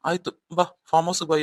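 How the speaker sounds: ordinary delivery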